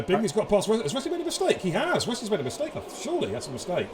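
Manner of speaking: excited. Real, not acted. Live commentary, sudden mistake.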